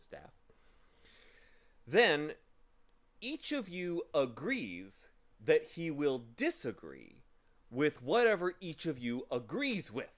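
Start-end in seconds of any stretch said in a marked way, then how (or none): 1.9-10.1 slow, high triumphant reasoning. Acted. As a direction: spell out the winning logic proudly. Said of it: "said Milo triumphantly".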